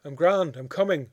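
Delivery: loud, high call into the house; calling back. A narrator's reading. Grieving mother calls back to the voice inside, loud reassuring call. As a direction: call out so they hear you inside.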